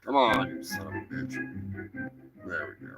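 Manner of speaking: ordinary talk, nothing marked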